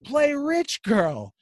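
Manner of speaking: high, very slow, drawn-out yelled order; mock scream. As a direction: holler it slowly at the speaker like a stubborn old dad. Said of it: "he keeps screaming".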